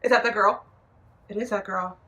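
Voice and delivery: ordinary talk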